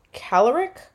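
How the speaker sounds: "caloric" stressed on the first syllable, like calorie; "caloric" with rising, unsure lift, stress up front; mispronounced caloric. Real, not acted. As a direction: say "caloric" as CAL-er-ic, questioning. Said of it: "not caloric".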